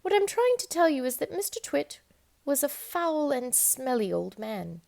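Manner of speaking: usual speaking voice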